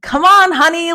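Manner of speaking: loud high mock-fatherly call; husband voice. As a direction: hurry her along like a helpful husband. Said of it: "he, like".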